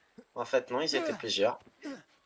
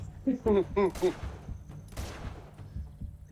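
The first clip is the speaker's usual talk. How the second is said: relieved laugh; 8 semitones higher, 4 dB quieter than usual. Real, not acted. After panic in game.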